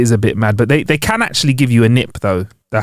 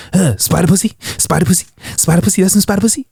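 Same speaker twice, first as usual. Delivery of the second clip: randy spider voice; 5 semitones higher than usual. Pretend. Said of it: "randy guys going out as a".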